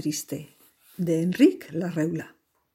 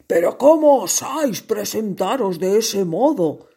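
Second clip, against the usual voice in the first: angry; 2 semitones higher, 6 dB louder than usual. Acted. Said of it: "le dijo el rey".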